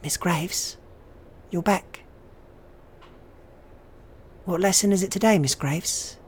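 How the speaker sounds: put-on high, eerie child's voice. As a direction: play a ghostly child greeting the teacher. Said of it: "And it basically goes".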